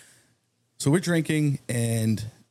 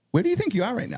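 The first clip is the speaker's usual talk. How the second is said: high incredulous cop voice, pitched way up; mock cop, raised pitch; 12 semitones higher, much faster than usual. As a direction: play the baffled cop talking down to him.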